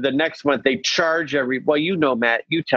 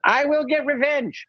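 homeless woman voice; 11 semitones higher than usual. Retold or imitated. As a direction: rant like the Chinatown yeller. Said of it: "She's like".

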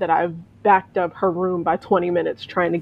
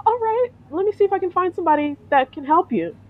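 much higher, strained-polite tone; pitched up, anxious customer-service voice; high nervous voice; 11 semitones higher than usual. Retold or imitated. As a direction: keep the nervous squeak while trying to sound helpful. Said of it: "my voice goes up, like, several octaves".

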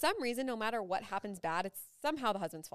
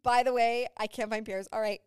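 mock frantic; 4 semitones higher, 9 dB louder than usual. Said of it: "I'd be like".

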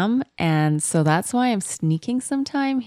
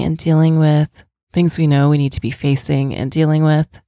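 louder, steady podcast ad read; sponsor ad read; 5 dB louder than usual. Acted. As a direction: read the sponsor message firmly and clearly. Reads a sponsor ad.

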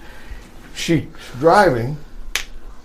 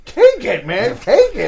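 urgent mock plea; 7 semitones higher than usual. Urging Sheik at border.

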